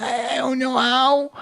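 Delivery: high, drawn-out fake wail; mock crying. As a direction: whine helplessly in a put-on sob. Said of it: "cried again".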